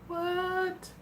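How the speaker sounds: high, squeaky mock-surprised what. Real, not acted. Host dreams of Kobe beef, squeaky surprised. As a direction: squeal it in disbelief.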